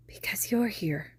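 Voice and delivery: soft unvoiced whisper; a crying boy's hush; tearful whisper. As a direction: breathe it through tears. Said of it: "tears leaking down his face".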